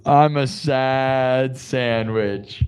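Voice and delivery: very slow, stretched words; drawn-out mock sadness. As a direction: drag each word out mournfully. Absurd sad self-description, very slow.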